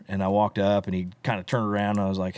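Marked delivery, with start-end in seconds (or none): none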